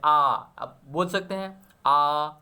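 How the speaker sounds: आ said slowly with the mouth wide open; open-mouth आ demo. Shown as a demonstration. Teacher showing students an open vowel, slow wide आ. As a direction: stretch आ out, jaw dropped wide. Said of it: "open पूरा mouth को हम लोग open कर दे रहे हैं".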